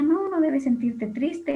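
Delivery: high, slow, chirpy cheer-up voice; cheerful Joy. Retold or imitated. Joy cheering up sad Bing Bong, high and slow. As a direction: play Joy brightly cheering up a sad friend. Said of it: "alegría con su alegría le decía".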